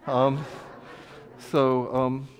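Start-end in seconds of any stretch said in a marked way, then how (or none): none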